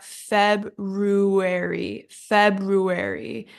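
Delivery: slow FEBRUARY as "FEB-roo-air-ee"; R sound pronounced. Shown as a demonstration. Teacher models the needed R, slow FEBRUARY as FEB-roo-airy. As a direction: draw out FEBRUARY with a clear RU after FEB. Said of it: "we need that".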